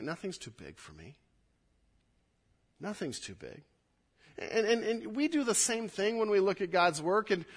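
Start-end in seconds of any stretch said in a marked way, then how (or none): 0.0-3.6 much softer, gentle certainty; quiet assurance. Acted. Pastor voices God answering the doubter, soft and sure. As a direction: soften right down and reassure. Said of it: "And God's like".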